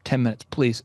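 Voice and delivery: low-pitched, imitated teenage plea; lightly acted haggling for a few minutes; retold humble begging, voice dropped low; younger self pleading. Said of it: "I'm eighteen-year-old version of myself".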